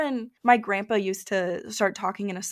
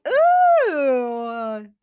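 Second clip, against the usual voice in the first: excited mock-Australian exclamation; lightly hammed-up Australian lilt; playful Australian accent; 2 semitones higher, 5 dB louder, much slower than usual. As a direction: slip into an Australian accent, drawn out. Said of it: "anytime anything has anything to do with Australia".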